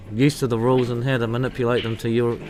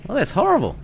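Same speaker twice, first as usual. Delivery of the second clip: laughing; 10 semitones higher, faster than usual. Real, not acted. Laughing at a nasty joke.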